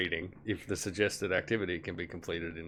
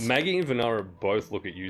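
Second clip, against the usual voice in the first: ordinary talk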